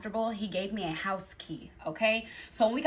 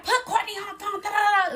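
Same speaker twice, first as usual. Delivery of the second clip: high, loud frantic clamour; mock hysterical demand, pitched way up; friends going crazy; 10 semitones higher, 6 dB louder than usual. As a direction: imitate worked-up friends all yelling down the phone. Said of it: "They're going crazy".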